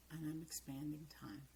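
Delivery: a hushed private reminder; whispered self-talk. Shown as a demonstration. Coach voicing a student's inner mantra, whispered. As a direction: whisper it to yourself. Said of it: "and you say".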